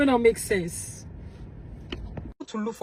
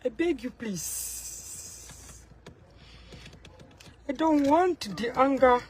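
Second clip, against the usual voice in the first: ordinary delivery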